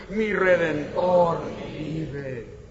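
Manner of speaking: Mi Redentor vive drawn out very slowly for the congregation to repeat; slow leading chant for the congregation; very slow call and response. Shown as a demonstration. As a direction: lead the crowd, stretching every syllable. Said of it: "A ver, dilo conmigo".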